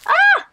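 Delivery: high, loud startled shriek; sudden high yelp; frightened shriek. Real, not acted. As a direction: shriek as if something jumped out. Bumps into things in game.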